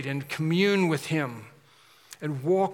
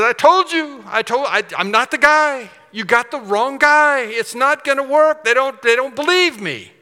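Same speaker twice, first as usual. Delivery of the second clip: high, loud, insistent complaining; acted-out Moses, worked up and protesting; agitated protest; 9 semitones higher, 9 dB louder than usual. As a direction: plead your case in loud exasperation. Moses protesting before God.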